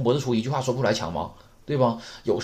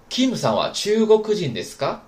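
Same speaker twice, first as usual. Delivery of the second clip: model reading; 8 semitones higher, 5 dB louder than usual. Shown as a demonstration. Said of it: "我们把这例子再读一下".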